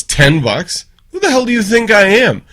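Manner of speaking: high, loud offended outburst; a waitress snapping back at a cheeky offer; mock indignant waitress. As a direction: ham up the insulted waitress's outrage. Waitress insulted in told joke.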